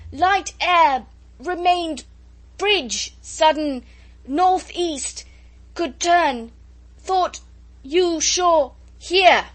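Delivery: high, loud fragments torn by the wind; shouted explanation. A narrator's reading. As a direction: shout to explain over the uproar. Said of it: "He tried to explain, with the uproar".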